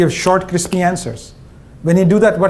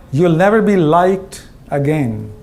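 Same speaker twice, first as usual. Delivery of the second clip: "never" drawn out, slow and low; slow, heavy warning; 3 semitones lower, slower than usual. Shown as a demonstration. CEO warning the audience, slow and grave. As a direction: let it land slowly like bad news. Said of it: "This one hurts".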